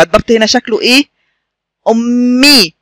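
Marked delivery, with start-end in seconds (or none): 1.9-2.7 "أمي" with a long hummed m and clear final ee; doubled m demonstration. Shown as a demonstration. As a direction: hold the double m in "أمي" for the learners. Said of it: "شدة وكسرة".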